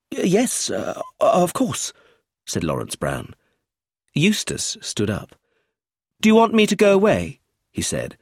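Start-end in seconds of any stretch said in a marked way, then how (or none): 0.1-1.9 higher, slow and hesitant; reluctant nervous agreement. A narrator's reading. Tutor to inspector, slow and nervous. 6.2-7.4 higher, louder, fast and pleasant; polite with a touch of superiority; slightly arrogant. A narrator's reading. Teenage boy to inspector, fast and a bit arrogant. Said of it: "pleasant, but slightly arrogant".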